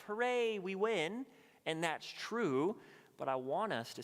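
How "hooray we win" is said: higher, louder hammed-up cheer; a tongue-in-cheek hooray; mock cheer. Mocking a shallow Easter view.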